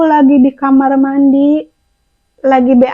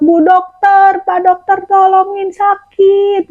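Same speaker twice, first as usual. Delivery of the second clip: higher, slow pleading call; mild mock yell for help; 6 semitones higher, slower than usual. Patient calling the doctors for help, mild mock yell. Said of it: "Aku teriak-teriak".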